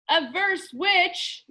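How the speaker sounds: a grand, slow pronouncement; booming. A narrator's reading. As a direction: boom it out like a judge. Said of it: "boomed Mister Lemoncello".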